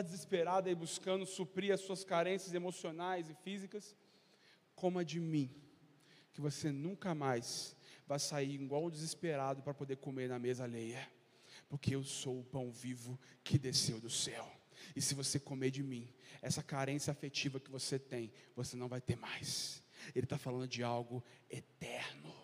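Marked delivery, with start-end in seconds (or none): 4.7-19.8 tender appeal. Acted. Preacher pleading softly with the hurting congregation. Intimate appeal, low and soft. 20.1-22.3 hushed whisper, lower and softer. Acted. Preacher whispering to the congregation about eternity.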